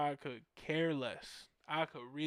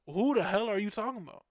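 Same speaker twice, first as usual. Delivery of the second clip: quick, higher, louder challenge; incredulous retort; 5 semitones higher, 6 dB louder, faster than usual. Retold or imitated. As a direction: fire back in acted disbelief. Retold disbelieving retort to friends.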